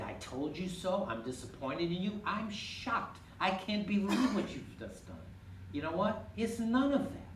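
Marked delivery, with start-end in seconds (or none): 0.0-5.2 higher, half-hearted scolding; mock angry Jesus. Preacher imagining Jesus scolding Peter, mildly mock indignant. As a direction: voice a disappointed teacher, lightly. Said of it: "Was he angry at him?".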